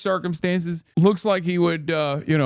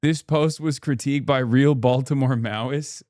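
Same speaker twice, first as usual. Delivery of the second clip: ordinary talk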